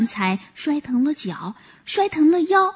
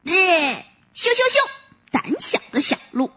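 mocking jeer; 5 semitones higher than usual. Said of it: "小猴叫着".

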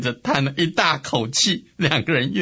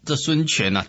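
ordinary delivery, nothing marked

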